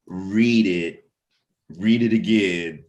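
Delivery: ordinary talk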